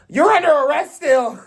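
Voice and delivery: high, loud mock of a stubborn soldier; hammed-up prissy officer repeating herself; squeaky mock order, loud. Mocking soldier's flat insistence.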